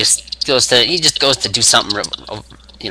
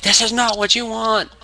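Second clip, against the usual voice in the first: mock rant; 11 semitones higher than usual. Wrestler ranting at crowd.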